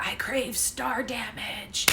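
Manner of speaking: slow, breathy little alien whisper; slow hushed alien voice, drawn out. Retold or imitated. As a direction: murmur it like a tiny deadpan alien. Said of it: "the little aliens that are like".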